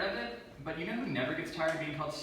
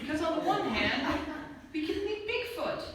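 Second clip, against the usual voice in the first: higher, hopeful lilt; mock hopeful; 12 semitones higher than usual. Comic weighing both sides, mock excited hope. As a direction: sell the dream of Bigfoot.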